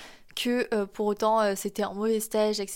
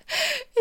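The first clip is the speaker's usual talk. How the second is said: short high giggle; embarrassed laugh; 3 dB louder than usual. Real, not acted. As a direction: break into a quick sheepish giggle. Embarrassed by her nose story.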